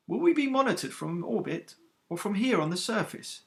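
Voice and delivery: a graduate asking with a hint of unease; faint nervous edge. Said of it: "with a faint nervous edge in their voice".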